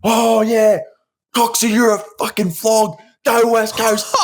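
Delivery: very high, rowdy mock yell; imitated heckling. Runner heckling Coxie on a selfie video, rowdy yell. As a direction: yell it like a taunting rival fan.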